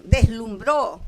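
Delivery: "deslumbró" con fuerza en la "bró" final; acento en la última. Shown as a demonstration. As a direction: carga la última sílaba de "deslumbró". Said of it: "No puede estar en la tercera". (acento agudo en "deslumbró", golpe en "bró")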